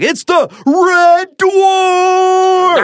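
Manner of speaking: very high, drawn-out announcer yell; announcer shout. Pretend. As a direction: introduce the champion to the arena. Announcing the dwarf's entrance.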